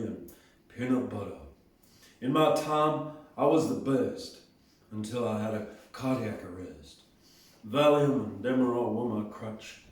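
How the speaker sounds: low Tennessee [name] voice, a touch lighter; [name] voice again. Retold or imitated. Encore of the [name] poem in a low Tennessee voice. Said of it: "Do it again".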